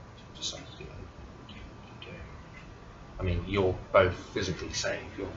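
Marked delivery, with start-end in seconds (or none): 0.1-2.2 whispered question. Acted. GM prompts players.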